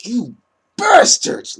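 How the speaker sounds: high, loud hammed-up curse; over-the-top mock yell of fury; mock outrage. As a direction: shriek it like a comic villain. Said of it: "come off like".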